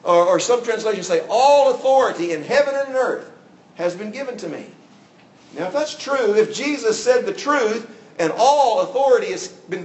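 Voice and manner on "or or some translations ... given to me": loud, higher proclamation; proclaiming. Acted. Preacher quoting Jesus' commission, loud and proclaiming. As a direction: ring it out like a pronouncement. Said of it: "Jesus himself ... said".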